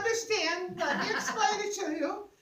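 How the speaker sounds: high, loud, laughing explanation; retold giddy elevator explanation; excited explaining. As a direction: tell strangers gleefully.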